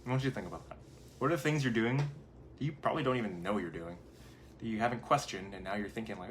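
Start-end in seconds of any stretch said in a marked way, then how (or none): none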